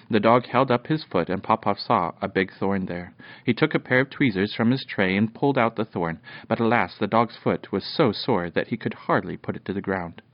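plain reading voice

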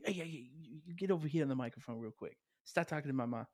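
light mock Long Island accent. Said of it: "impersonate his Long Island accent".

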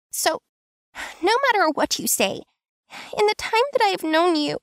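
high, fast, emotional female voice; voice cracking. A narrator's reading. Tansu insisting to Kim, voice on the edge of cracking. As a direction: hold back tears as you insist. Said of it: "Her voice cracked a little".

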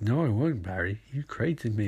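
a hushed computer assistant replying; calm AI voice. Retold or imitated. Gideon answering Barry, AI voice.

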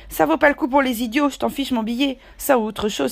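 a high, loud outburst from Silver; high-pitched loud retort to the young sailor; exclaiming. A narrator's reading. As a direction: cry it out high and loud, scoffing at fools. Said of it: "s'écria Silver".

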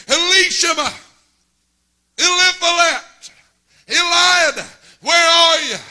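loud high call into the yard. Retold or imitated. Father calling the boys a second time, loud high call. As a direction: holler their names across the yard.

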